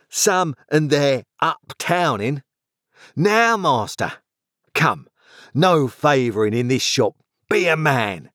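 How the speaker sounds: slow sneer rising into a challenge; surly growl. A narrator's reading. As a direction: goad the boss as a disgruntled workman. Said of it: "growled Orlick".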